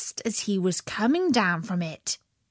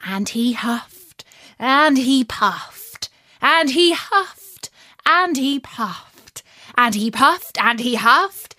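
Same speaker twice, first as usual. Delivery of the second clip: ordinary delivery, nothing marked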